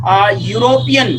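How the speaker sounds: यूरोपियन said slowly, opening on a clear yoo sound; sounding out European. Shown as a demonstration. Teacher sounding out यूरोपियन for the class, slow and clear. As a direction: stretch out आ, यूरोपियन so the first sound stands out. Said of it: "अब इसको बोलकर देखते हैं".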